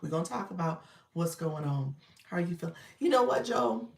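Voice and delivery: ordinary talk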